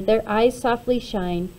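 plain reading voice

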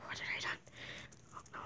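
whispered in shock; horrified whisper. Acted. Thomas after the killing, whispering in horror. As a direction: breathe it out, appalled at yourself. Killer toy realises what he did.

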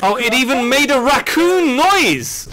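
high, slow, drawn-out squeal; delighted excitement. Real, not acted. Gamer delighted by the raccoon mascot, excited squeal. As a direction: squeal it, stretching the words in delight.

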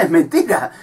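usual speaking voice

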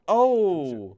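loud, high cry of "oh"; startled "oh". Real, not acted. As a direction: let out a big drawn-out "ohh". Sudden exclamation at the game.

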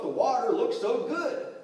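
high, loud, drawn-out excuse; acted boyish pleading; defensive son. A narrator's reading. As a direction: justify yourself like a tempted boy. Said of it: "he defended himself".

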